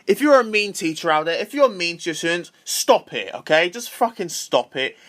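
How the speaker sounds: normal talking voice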